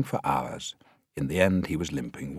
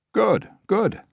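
much higher, louder pleased director; director approving; 6 dB louder than usual. A narrator's reading.

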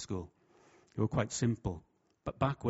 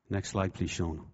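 ordinary talk

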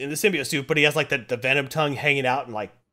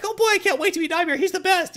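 high, fast, excited fan voice; giddy fan; 4 dB louder, faster than usual. Retold or imitated. Fans waiting to meet Nightmare, high giddy gushing. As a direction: gush like an eager fanboy.

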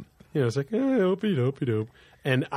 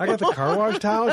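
incredulous; 9 semitones higher, 3 dB louder than usual. Retold or imitated. Incredulous quote of himself to mom.

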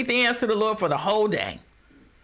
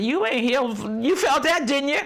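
taunting devil; 6 semitones higher than usual. Acted. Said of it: "And the devil said".

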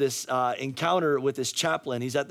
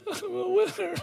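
high, whiny imitation of crying kids; mock whimpering kids. Losing kids echoing their coach, mock tearful whimper. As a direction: squeak it out tearfully, unconvinced, hammed-up.

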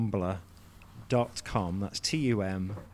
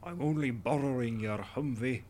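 lightly done Scottish movie tough guy; a hint of Connery's growl; Sean Connery impression; 3 semitones higher than usual. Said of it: "that's my Sean Connery in The Rock impression".

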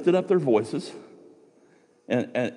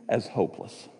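usual speaking voice

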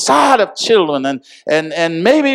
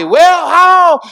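preached command; 10 semitones higher, 5 dB louder than usual. Acted. Loud high preaching command.

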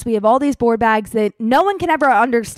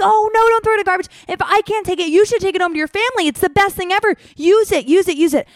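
much higher, fast pleading rush; frantic pleading; 9 semitones higher, 5 dB louder, faster than usual. Retold or imitated. Traveler frantically urges agent to take the peanut butter home, pleading. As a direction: beg her to keep it instead.

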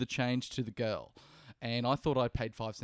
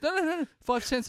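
put-on high, insistent girl's voice, much higher; a hammed-up high cashier insisting; mimicking the cashier; 13 semitones higher than usual. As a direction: squeak it out like a young cashier. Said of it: "she goes".

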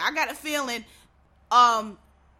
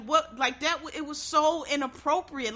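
normal talking voice

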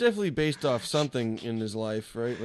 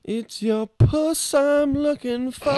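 squeaky drawn-out creep voice; high slow silly voice; 4 dB louder, much slower than usual. Acted. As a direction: falsetto goofy dub voice. Dubbing video, silly voice.

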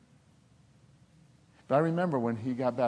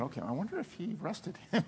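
higher, softer, amused aside; wry inner thought; 6 semitones higher, 7 dB quieter than usual. Retold or imitated. Pastor recalling his thought about the officer, wry and amused. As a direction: let the joke show in your thought.

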